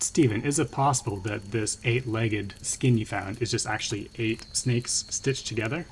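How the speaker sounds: ordinary talk, nothing marked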